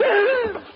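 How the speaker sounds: a startled intake of breath; horrified gasp at the ceiling; shocked gasp. Acted. Sudden shock.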